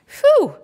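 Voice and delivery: high, loud sigh of relief; relieved phew. A narrator's reading. Polly relieved the wind died, high loud phew. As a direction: blow out a big phew.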